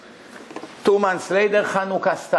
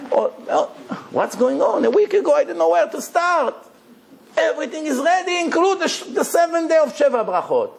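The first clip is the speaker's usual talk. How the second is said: much higher, astonished voice, rushing a little; amazed parent; 11 semitones higher than usual. Retold or imitated. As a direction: play a parent stunned that all is ready. Mimics amazed parent at wedding.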